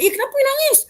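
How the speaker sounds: shocked high question; startled question. Retold or imitated. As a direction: gasp it out in alarm at the crying. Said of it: "Aku kata".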